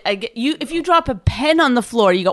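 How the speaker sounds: ordinary talk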